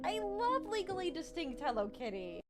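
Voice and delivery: high, delighted gushing; squealing excitement. Real, not acted. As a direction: squeal over a favourite find. Thrilled at finding Hello Kitty.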